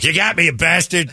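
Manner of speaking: a caught squirrel grudgingly conceding. Retold or imitated. Trapped squirrel glaring at its captor, high grumbling voice.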